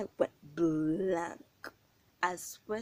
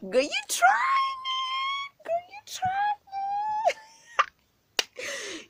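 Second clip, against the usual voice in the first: very high, slow wailing cry; outraged cry; 5 dB louder, much slower than usual. Real, not acted. To the girl who pushed her, a very high drawn-out outraged wail.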